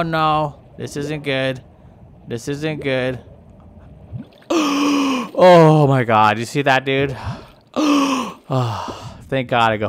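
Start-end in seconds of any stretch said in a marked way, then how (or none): 4.5-5.3 gasp for air. Pretend. Surfacing from underwater.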